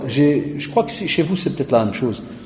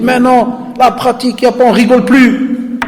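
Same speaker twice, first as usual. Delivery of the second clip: mock kid's voice; 10 semitones higher, 5 dB louder than usual. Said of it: "Il me dit".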